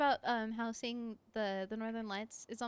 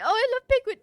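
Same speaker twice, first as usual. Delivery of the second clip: high, loud mock gushing; mocking basic fan; 11 semitones higher, 15 dB louder, faster than usual. Streamer mocking a basic penguin lover, hammed-up. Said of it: "to be like".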